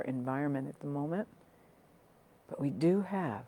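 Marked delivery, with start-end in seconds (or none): none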